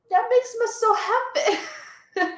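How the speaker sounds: high, slow happy squeal; moved delight breaking into laughter; overjoyed, emotional. Real, not acted. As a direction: squeak it out, drawn out and tearfully happy.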